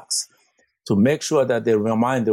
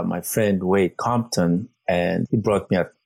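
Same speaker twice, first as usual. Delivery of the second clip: ordinary talk, nothing marked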